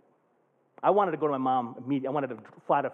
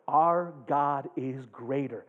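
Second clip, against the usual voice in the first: ordinary talk, nothing marked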